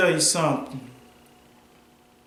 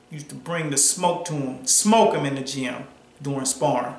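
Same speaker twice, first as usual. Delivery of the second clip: ordinary talk, nothing marked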